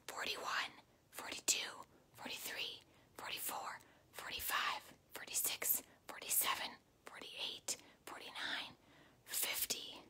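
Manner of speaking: whispered counting. Shown as a demonstration. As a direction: breathe the numbers softly. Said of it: "do a whisper voice".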